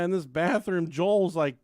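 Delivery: normal talking voice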